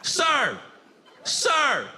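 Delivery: high, insistent put-on passenger voice; pestering seat-switcher. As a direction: keep calling for attention in a high, nagging voice. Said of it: "They'll just come over".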